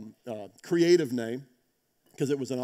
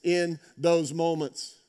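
louder, higher, urging the congregation to hold on; preaching at full force; 6 semitones higher, 7 dB louder than usual. Acted. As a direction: preach it out with rising conviction. Opening exhortation to the congregation.

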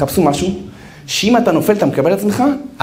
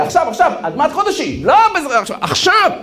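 urgent insistence; 11 semitones higher, faster than usual. Real, not acted. Preacher urging immediate action.

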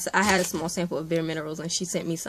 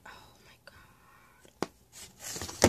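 barely audible, drawn-out whisper; awed whisper; 8 dB quieter, much slower than usual. Real, not acted. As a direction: whisper it in disbelief. Overcome by the gift.

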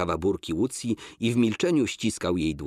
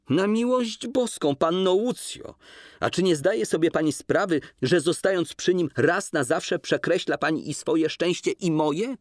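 much higher, urgent man's plea; lightly voiced man, desperate appeal; Kolski pleading; 10 semitones higher than usual. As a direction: beg her to see sense.

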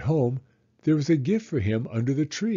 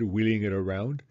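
ordinary delivery

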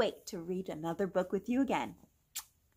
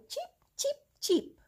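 high peeping chick; high chirpy baby chick voice; chick cheeps. Pretend. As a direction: cheep like a tiny chick, much higher. Chick sounds in farm book.

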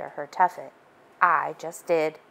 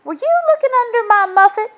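put-on much higher, louder indignant girl; indignant Miss Muffet; 8 dB louder, faster than usual. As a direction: Muffet outraged at Joe snooping.

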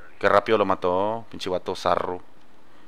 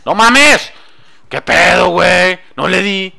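loud, high outburst at a miss; frustrated shout; 7 semitones higher, 10 dB louder, much slower than usual. Real, not acted. Missed shot, loud outburst.

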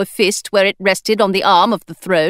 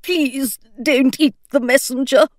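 put-on old man mumbling; 5 semitones higher than usual. A narrator's reading. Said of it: "the old man mumbled again toward the floor".

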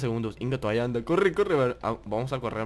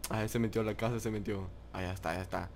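much softer, low hushed voice; 5 semitones lower, 10 dB quieter, faster than usual. Real, not acted. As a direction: say it hushed, hiding. Hiding from the killer.

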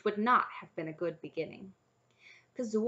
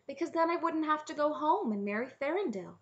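higher-pitched princess voice; explaining eagerly, pitched up; earnest princess; 8 semitones higher, 3 dB louder than usual. A narrator's reading. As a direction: plead your case as a young princess. Princess pleads her case to dragons.

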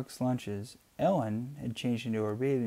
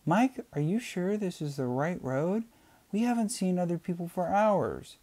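higher, anxious wife voice; lightly voiced worried mother; wife fretting about the empty road, voiced higher; 8 semitones higher than usual. A narrator's reading. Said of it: "Ellen said".

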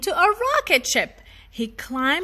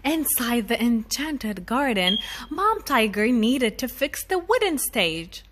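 plain reading voice

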